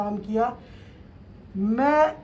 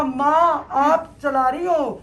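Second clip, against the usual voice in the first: loud, raised-pitch appeal to Mother; emphatic devotional cry, retold at full voice; fervent retold plea; 3 semitones higher, 6 dB louder, slower than usual. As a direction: call out to the goddess with conviction. Retells fervent plea to goddess.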